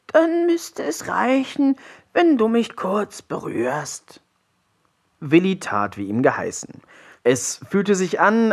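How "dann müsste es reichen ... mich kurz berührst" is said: very high, slow creature voice; gasping troll frog. A narrator's reading. Frog tells Willi how to heal him, gasping slowly. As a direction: drag out the words as an ailing frog. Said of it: "keuchte der Trollfrosch".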